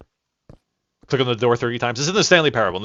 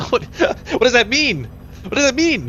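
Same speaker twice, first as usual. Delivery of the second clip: laughing; 13 semitones higher, 4 dB louder than usual. Real, not acted. Laughing at "non-existing accents.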